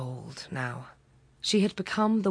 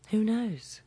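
whispered musing; 5 semitones higher than usual. A narrator's reading. Narrator's musing question, whispered.

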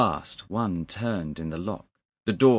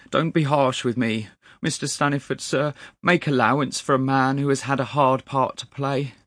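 higher, louder appeal; pleading clerk; 6 semitones higher, 6 dB louder than usual. A narrator's reading. Clerk begs his master's son not to be harsh, pleading. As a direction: beg for allowance, earnestly.

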